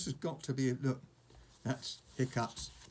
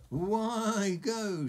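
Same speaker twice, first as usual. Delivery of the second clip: high, loud, frightened little dragon voice; cling on and quaver in fear; shuddering dragon; 11 semitones higher, 7 dB louder than usual. Acted. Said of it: "shuddered Toothless".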